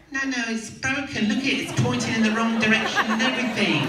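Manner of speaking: high, loud, insistent nurse voice; alarmed set nurse. Retold or imitated. Nurse arguing with actor about his toe, high and loud. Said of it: "I really wanna hear the nurse".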